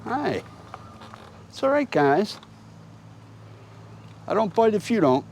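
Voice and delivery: high, gentle coaxing voice to a deer; wildlife watching whisper. Real, not acted. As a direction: soothe a skittish animal softly. Soothing a doe and her fawn.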